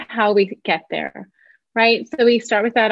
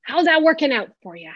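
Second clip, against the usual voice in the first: wry question; 5 semitones higher than usual. Retold or imitated. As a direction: pitch lifts high in a dry, ironic challenge. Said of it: "I say".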